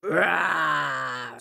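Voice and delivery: short roar; lion roar. A narrator's reading. Lion roaring for silence, short roar. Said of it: "roared again for silence".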